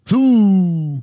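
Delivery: "Zoo" yelped high and loud in a silly voice; cartoonish high "Zoo"; mock zoo. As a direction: tease with a squeaky "Zoo". Teasing repetition of zoo.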